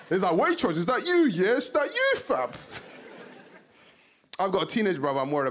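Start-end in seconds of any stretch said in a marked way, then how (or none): 0.3-2.5 high London street challenge, dropping at the end; mocking rude boy. Rude boy taunting a Waitrose shopper, high street voice. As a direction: question him like a confrontational rude boy. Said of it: "rude boy's".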